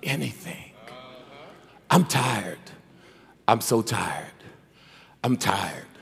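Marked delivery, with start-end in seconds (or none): 1.9-5.9 much lower and softer, droning the complaint; hammed-up tired sigh of a lazy churchgoer; mock weary moan. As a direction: let each line sink lower like an excuse. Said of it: "that's their excuse for not doing".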